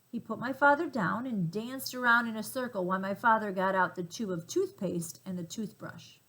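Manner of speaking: plain reading voice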